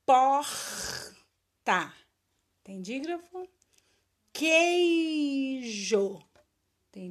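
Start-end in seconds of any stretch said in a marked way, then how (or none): 0.1-1.9 "Porta" loud, in two separated syllables. Shown as a demonstration. Teacher dictating to pupils who look for digraphs, syllable by syllable. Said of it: "Separa as sílabas". 4.3-6.2 "Queijo" loud and long drawn out; syllable by syllable. Shown as a demonstration. Teacher dictating to pupils who look for digraphs, syllable by syllable. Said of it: "Separa as sílabas".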